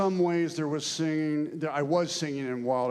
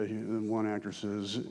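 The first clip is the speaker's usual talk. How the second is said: normal talking voice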